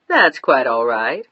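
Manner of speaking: lightly voiced calm operator; a hint of a relaxed Californian operator; unhurried operator. A narrator's reading. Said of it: "operator sounded Californian and unhurried".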